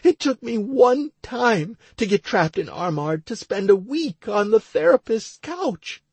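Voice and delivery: tearful, high-pitched woman's voice; Stacy. A narrator's reading. Crying wife explaining her panic to her husband, tearful. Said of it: "still crying her eyes out".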